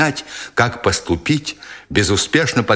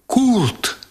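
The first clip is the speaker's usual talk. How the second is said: high, anguished call; sobbing cry; 12 semitones higher than usual. A narrator's reading. German woman calls to Kurt below, high sobbing cry. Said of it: "рыдающе вскрикнула немка".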